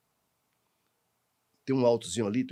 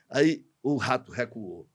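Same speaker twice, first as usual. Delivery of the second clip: ordinary delivery, nothing marked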